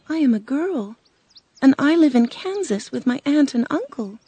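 put-on high little-girl storybook voice; earnest young Dorothy. Dorothy tells the witch who she is, earnest.

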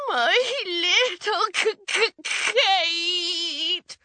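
high, stuttering, tearful wail; upset wailing. A narrator's reading. Mother over her bewitched daughter, stuttering wail. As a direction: sob on the name, stretching it out. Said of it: "Missus Crumb was very upset".

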